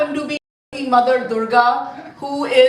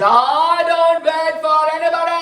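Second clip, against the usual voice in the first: loud, higher-pitched proud outburst; indignant Parshuram; 4 semitones higher, 4 dB louder than usual. Acted. Proud warrior refusing to wait at the door, indignant shout. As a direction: holler it impatiently, drawing out the end. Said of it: "And Parshuram said".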